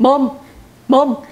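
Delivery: loud cartoon child voice; insistent cartoon calls for mother; Family Guy impression. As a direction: pester like a cartoon kid. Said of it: "I told you I was Family Guy".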